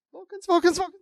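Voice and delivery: high shout passing by; high cartoon call, as if whizzing past; imitated Doppler effect. Cohost mimicking how Boimler's shout sounds, high Doppler-like call. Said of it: "almost a Doppler effect on Boimler's voice".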